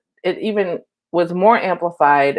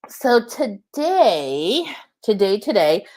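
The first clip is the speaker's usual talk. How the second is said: drawn-out today; 5 semitones higher, much slower than usual. Real, not acted. Stretching to start topic.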